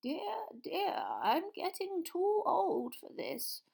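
put-on high, slow, frail old voice; feeble old man. Ancient wizard sighing at the execution, feeble. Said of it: "said in a feeble voice".

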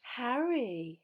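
high, loud delighted greeting; beaming greeting. A narrator's reading. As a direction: greet with a big beaming smile.

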